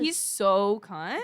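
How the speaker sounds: slow, loud, drawn-out praise; drawn-out emphasis. Real, not acted. Gushing to her co-host, slow and drawn out.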